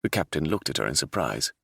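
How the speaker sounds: plain reading voice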